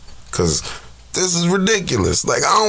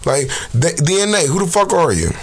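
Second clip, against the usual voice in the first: normal talking voice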